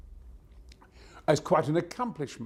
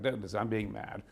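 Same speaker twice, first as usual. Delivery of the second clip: usual speaking voice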